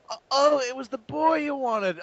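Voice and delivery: whiny Starscream. Retold or imitated. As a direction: whine out the excuse. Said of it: "Starscream's like".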